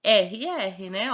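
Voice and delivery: ordinary talk, nothing marked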